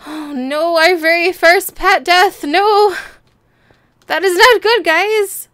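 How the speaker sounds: loud lament over the dead kitty; dismayed exclaim. Real, not acted. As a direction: cry it out to the viewers. Reacting to pet death.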